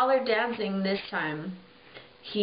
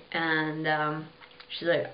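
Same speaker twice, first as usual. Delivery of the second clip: usual speaking voice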